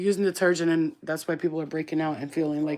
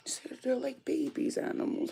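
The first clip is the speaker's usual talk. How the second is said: unvoiced tender whisper; 8 semitones higher, 6 dB quieter than usual. Real, not acted. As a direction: breathe it softly. Whispering near frightened dog.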